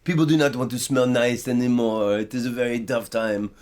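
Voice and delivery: put-on a deliberately terrible French accent for a perfume seller; bad French accent. Telling a friend about a gloomy Paris perfumer, in a mock French accent. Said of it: "I do the worst French accent".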